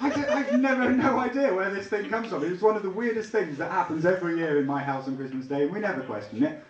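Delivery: usual speaking voice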